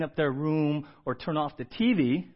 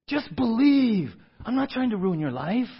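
coaxing parent; 3 semitones higher than usual. Retold or imitated. Parent pleading with child at bedtime.

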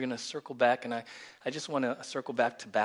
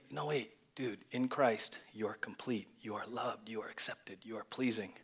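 quiet self-reassurance; 2 semitones lower, 9 dB quieter than usual. Retold or imitated. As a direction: reassure yourself under your breath. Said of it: "I would do that".

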